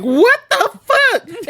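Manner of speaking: very high, slow "what the fuck"; squealed disbelief; high wailing dismay. Retold or imitated. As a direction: stretch it out in a wail. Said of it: "I text Covey and I'm like".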